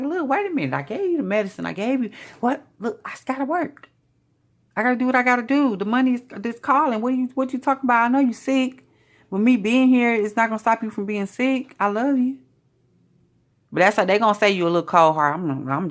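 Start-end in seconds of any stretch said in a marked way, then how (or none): 0.0-3.9 higher-pitched, put-upon protest; acted-out exasperated back-talk; exasperated partner. Acting out a quarrel with sick partner. 4.8-12.4 higher-pitched, insistent self-justifying; acted-out protest in a raised voice; defensive partner. Acted reply to sick partner.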